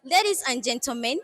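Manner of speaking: formal address to an audience; formal speech. Acted. Head girl opening her speech to viewers, formal address. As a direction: speak like at a podium.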